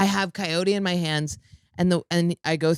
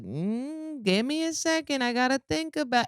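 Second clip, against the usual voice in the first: higher, softer, dithering mock TSA voice; mock dithering agent; 7 semitones higher, 4 dB quieter than usual. TSA agent stalling a hurried traveler, mock dreamy.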